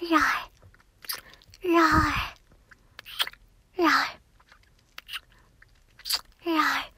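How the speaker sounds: cute pretend roar, lightly voiced; playful mock roar. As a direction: play at being a little lion. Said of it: "roar into your ears".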